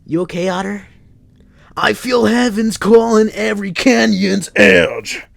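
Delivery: very high, loud hammed-up wail; theatrical lament. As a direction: overplay a grief-stricken preacher. [name] speaks.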